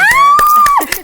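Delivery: a high, loud joyful cry; excited exclamation. Real, not acted. Host picturing the guest winning the Nobel Prize, delighted cry. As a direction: shout it out in delight.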